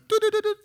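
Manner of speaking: high sing-song humming; innocent humming. Pretend. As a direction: hum like you hear nothing. Pretending not to listen.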